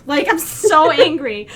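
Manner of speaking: normal talking voice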